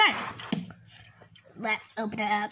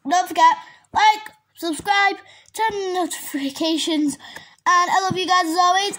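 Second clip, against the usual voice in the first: higher, louder, upbeat outro; loud sign-off; 6 semitones higher, 5 dB louder than usual. Real, not acted. To viewers at the end of the vlog, a loud cheerful sign-off. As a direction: shout out the goodbye to your fans.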